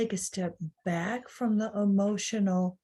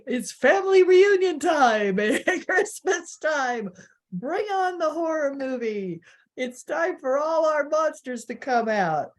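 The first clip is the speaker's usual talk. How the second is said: loud, higher, laughing hype; mock-excited announcing; 6 semitones higher, 4 dB louder than usual. Host jokes about family reunions at Christmas, mock-excited announcing. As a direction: playfully herald the holiday horrors.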